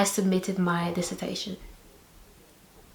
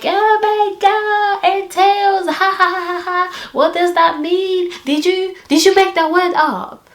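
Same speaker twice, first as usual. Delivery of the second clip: high, loud mock-laughing imitation; mocking ridicule; 10 semitones higher, 6 dB louder than usual. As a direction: mimic someone cackling at your word choice. Acts out supervisor ridiculing her word.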